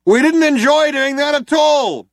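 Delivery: loud, high, slow shout; shouted apology. A narrator's reading. Cop shouting an apology to the fugitives, loud. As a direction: bellow the regret. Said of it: "shouted the other cop".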